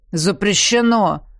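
slow, stern jailer; stern refusal. Acted. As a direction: refuse it strictly like a guard. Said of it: "строго возразил второй тюремщик".

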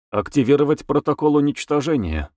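usual speaking voice

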